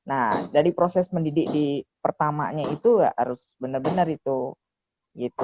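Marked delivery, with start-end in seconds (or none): none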